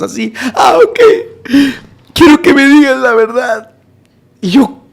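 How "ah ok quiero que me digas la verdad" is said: high-pitched acted wail; imitated tearful plea. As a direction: beg like a heartbroken man. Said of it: "el corazón se le desgarraba".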